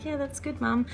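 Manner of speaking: mock toddler reply. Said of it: "looks at me like".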